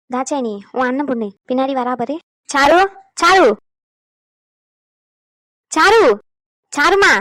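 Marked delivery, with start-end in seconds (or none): 2.5-7.1 loud, raised call of a name. Acted. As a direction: call out to get her attention. Calling out to absent-minded girl Charu.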